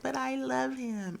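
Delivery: high, drawn-out lovesick plea; mock lovesick. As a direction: stretch it out, imitating a smitten wife. Replaying friend's lovesick line on request.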